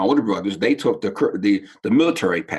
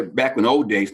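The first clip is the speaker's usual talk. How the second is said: usual speaking voice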